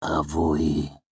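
drawn-out, befuddled utterance; dazed mercenary groping for words; slow, thick voice. A narrator's reading. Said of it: "his voice slow and thick".

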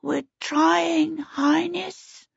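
very high, little creature voice; small, high plea to a master; small piping voice. A narrator's reading. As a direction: pipe up meekly as a tiny overworked servant. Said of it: "piped a small, panting voice".

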